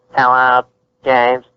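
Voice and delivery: halting gimmick voice, word by word; Kane. Retold or imitated. Co-host mimics a wrestler greeting someone in the street, halting gimmick voice. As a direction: lightly imitate a wrestler's stilted character voice. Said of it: "they do have things called gimmick voices".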